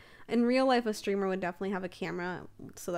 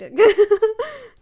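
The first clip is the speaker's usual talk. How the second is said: loud high laugh; 12 semitones higher, 16 dB louder than usual. Real, not acted. Laughs at weird windows.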